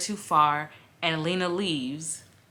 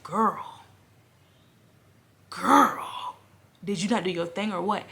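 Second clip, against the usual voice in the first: imagined reader reaction; 2 semitones higher, 3 dB louder than usual. Retold or imitated. Imagined reader calling out the reviewer, mock scolding. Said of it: "you read it and you're like".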